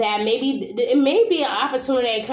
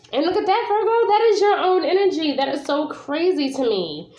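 excited; 7 semitones higher than usual. Real, not acted. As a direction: gasp at the card, delighted. Thrilled by the card that came up.

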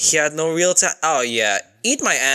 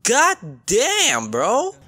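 very high, drawn-out yelp; alarmed outburst; much slower than usual. Real, not acted. Fan telling an on-screen demon to calm down, alarmed. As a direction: plead slowly, way up high, in alarm.

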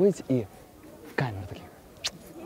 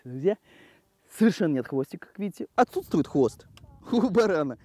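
ordinary delivery

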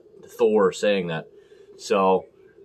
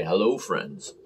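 Jim Nance greeting; 5 semitones lower, 4 dB quieter, slower than usual. Retold or imitated. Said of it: "Jim Nance in Australia".

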